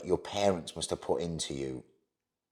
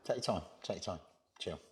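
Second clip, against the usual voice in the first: gentle reassurance; 11 dB quieter, much faster than usual. Real, not acted. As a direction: quietly give them room to recover. Interviewer comforts guest who broke off upset.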